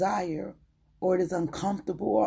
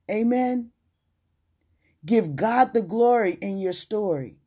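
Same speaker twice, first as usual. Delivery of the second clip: loud, fervent preaching; 5 dB louder than usual. Acted. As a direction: proclaim it to the congregation. Preaches with rising force.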